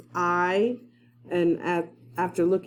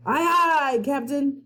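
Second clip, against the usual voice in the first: pirate crewman; 8 semitones higher, slower than usual. Acted. Pirates answering the captain.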